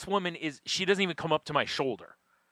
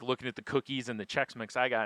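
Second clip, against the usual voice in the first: usual speaking voice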